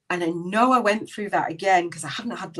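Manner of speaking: ordinary talk, nothing marked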